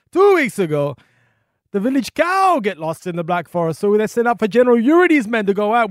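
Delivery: loud, high, hammed-up Russian accent; General Yuri. Old soldier telling a visitor the town's story, booming Russian accent. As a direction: bellow the tale like a comic Russian general. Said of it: "I'm terrible with accents".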